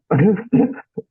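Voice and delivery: a sudden high laugh at his own folly; burst of laughter. Real, not acted. Laughing at himself for not checking the weather.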